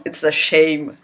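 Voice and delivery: usual speaking voice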